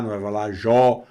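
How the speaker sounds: jó said with an open o; jó, open o vowel; wrong vowel. Shown as a demonstration. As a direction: show the wrong jó. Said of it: "É je".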